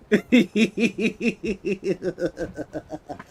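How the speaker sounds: long, high cackle that trails down; long laughing fit. Real, not acted. Streamer amused by a troll, long cackle. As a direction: laugh on and on till it runs out.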